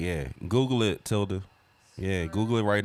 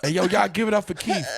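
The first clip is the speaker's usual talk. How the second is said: higher, louder hype intro; hype host; 12 semitones higher, 6 dB louder than usual. Pretend. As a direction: hype up the crowd like an MC. Host introducing him at show.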